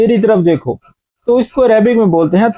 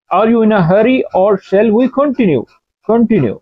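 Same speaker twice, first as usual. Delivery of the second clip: slow and clear; much slower than usual. Shown as a demonstration. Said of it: "slow English gloss".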